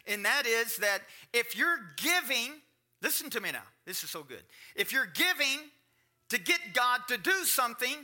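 high and loud pulpit delivery, pressing each point; preaching at full force. Acted. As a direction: hammer the point home to the congregation.

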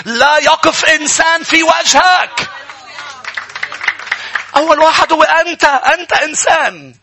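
high, loud rallying declaration; full-force preaching. Real, not acted. Preacher promising the congregation nobody will stand against them, full-force preaching.

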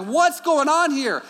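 loud, high and slow, mock bewildered; baffled. Husband baffled by the food standoff, loud slow mock bewilderment. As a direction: throw up your hands in mock disbelief.

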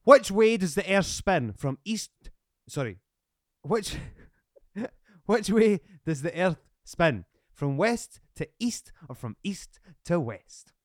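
slow, loud quiz-show question; quizmaster. Pretend. Host asking guest a space question, quizmaster delivery. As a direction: pose it slowly like a game-show host.

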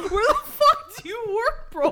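high, laughing disbelief with stretched words; laughing incredulity. Retold or imitated. Friend mock-questioning the clerk who isn't working there, laughing disbelief. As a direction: play utterly baffled, cracking up as you go.

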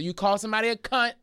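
high-pitched public call-out; announcing to everyone. Retold or imitated. Turning round to the bystanders, announcing it. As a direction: say it so the whole crowd hears. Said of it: "make sure everybody hears it".

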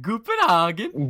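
slow, loud drawn-out mock German "Guten Hagen"; mock German greeting. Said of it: "a deep German voice".

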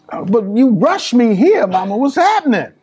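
high-pitched retold bafflement; worked-up, high questioning; bewildered protest. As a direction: act out a son demanding an explanation. Puzzled son questions his mother.

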